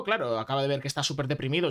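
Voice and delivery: ordinary talk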